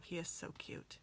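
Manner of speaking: soft awed whisper. Real, not acted. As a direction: whisper it like it's too cute to say aloud. Whispering over a cute hedgehog.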